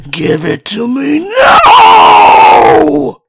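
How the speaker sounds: slow, drawn-out eerie howl rising high into a shout; eerie creature wailing its threat inside the cabin; menacing creature wail. Acted.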